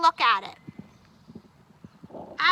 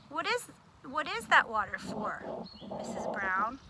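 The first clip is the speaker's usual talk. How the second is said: soft, polite, lower question; 5 semitones lower, 7 dB quieter than usual. Shown as a demonstration. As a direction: ask it kindly. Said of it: "the difference between saying".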